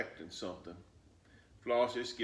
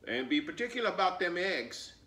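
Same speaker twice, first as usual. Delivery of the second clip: higher, louder call; calling after her; 9 semitones higher, 6 dB louder than usual. Acted. Grandmother calling after Flossie, raised voice. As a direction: call after the child as she leaves. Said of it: "Big Mama called".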